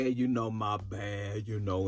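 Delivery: low, slow, soft drawl; dopey drugged-sounding imitation; mocked drawl. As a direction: imitate his sluggish low voice, dragging it.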